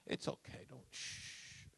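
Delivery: whispered hush, soft and calm; soothing whisper. Retold or imitated. Wife whispering to calm her husband after a nightmare. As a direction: hush him back to sleep in a whisper. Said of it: "She just go".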